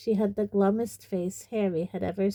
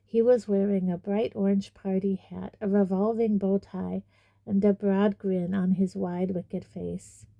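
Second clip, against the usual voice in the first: usual speaking voice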